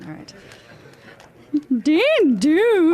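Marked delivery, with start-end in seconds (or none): 1.8-2.9 doorbell. Acted. Doorbell sound imitated.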